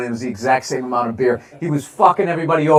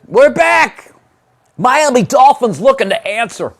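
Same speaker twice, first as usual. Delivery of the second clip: high, loud hype announcer; excited; 8 semitones higher, 6 dB louder, slower than usual. Real, not acted. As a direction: burst back on air. Announcing the stream is back.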